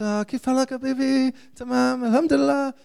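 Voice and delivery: lightly acting the smiling boy; cheerful Arabic greeting.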